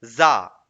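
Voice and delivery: "जा" loud and separate, falling; single syllable. Shown as a demonstration. Teacher counting the second syllable, loud isolated "जा". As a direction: punch out "जा" as its own beat. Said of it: "दूसरा हो गया".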